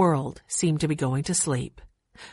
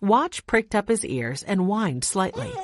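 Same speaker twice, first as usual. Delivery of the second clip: plain reading voice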